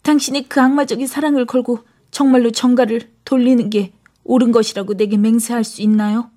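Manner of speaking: high, urgent female character voice; crying out. A narrator's reading. Christine grabs Erik's hand to demand an oath, crying out. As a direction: cry out pleading, high and urgent. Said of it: "크리스틴이 재빠르게 괴물의 손을 잡으며 소리쳤다".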